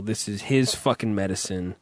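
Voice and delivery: usual speaking voice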